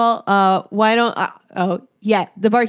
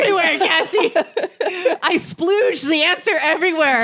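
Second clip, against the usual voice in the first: laughing; 6 semitones higher than usual. Real, not acted. Host cracking up at own mistake.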